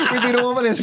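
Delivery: high, giggling exasperated wife; laughing. Retold or imitated. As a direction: laugh through the scolding. Laughing while voicing the wife.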